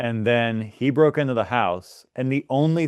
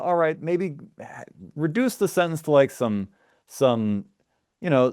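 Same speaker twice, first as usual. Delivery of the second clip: ordinary talk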